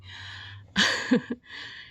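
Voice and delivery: breathy laugh at oneself; self-deprecating laugh. Real, not acted. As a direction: laugh off your own reveal. Laughs after revealing it's about them.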